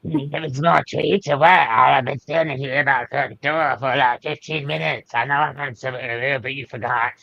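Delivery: crotchety pet voice, complaining; Andy. Acted. Owner voices a pet kept waiting at the door, crotchety. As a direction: grumble at the owner in a put-on crotchety voice. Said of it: "Andy sounds kinda like Luna".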